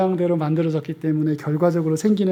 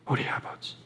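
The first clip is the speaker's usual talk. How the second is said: whispered prayer; 14 dB quieter than usual. Acted. Quoting the Lord's Prayer.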